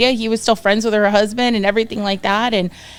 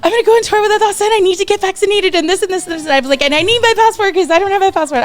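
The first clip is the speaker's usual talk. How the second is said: high, fast, louder rush of joy; giddy retold excitement, much higher and quicker; ecstatic; 9 semitones higher, 3 dB louder, much faster than usual. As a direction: blurt it out, overjoyed and racing. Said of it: "so ecstatic".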